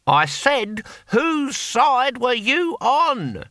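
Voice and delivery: very slow, high shout for deaf ears; exasperated, drawn-out shouted question. A narrator's reading. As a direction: call it out word by word, pitched right up. Repeats louder for deaf comrade.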